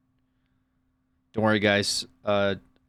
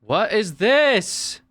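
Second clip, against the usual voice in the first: much higher, louder, slowly drawn out; stunned disbelief; 9 semitones higher, 6 dB louder, much slower than usual. Real, not acted. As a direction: let it out in appalled disbelief. Stunned at meme on screen.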